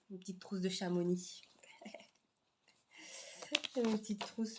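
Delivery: whispered through a little laugh; amused breathy chuckle under the words; giggly whisper. Real, not acted. Whispering with a giggle to viewers about her pouch.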